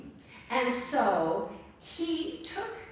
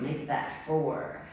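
a deadpan correction, low and unhurried; low wry aside; 9 semitones lower, slower than usual. Acted. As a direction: drop low and slow for a dry aside.

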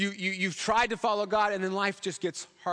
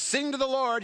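high-pitched, joyful call to sing; happy praise; 7 semitones higher than usual. Acted. Preacher quotes Jeremiah singing to God, happy. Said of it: "you know, he's all happy".

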